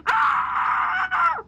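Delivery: short high-pitched scream; high shriek. Acted. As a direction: let out a sudden shriek. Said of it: "proceed to scream normally".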